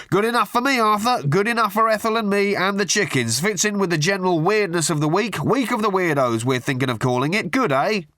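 butter him up with absurd pet names; gushing hack journalist. A narrator's reading. Tabloid journalist gushing down the phone at Arthur. Murray's voice again.

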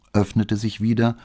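ordinary delivery, nothing marked

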